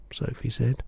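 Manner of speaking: plain reading voice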